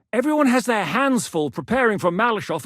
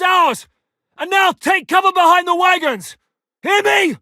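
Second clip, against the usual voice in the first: much higher and louder commands; urgent shouting; 9 semitones higher, 7 dB louder than usual. Acted. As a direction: yell them to cover. Ordering cover during fight.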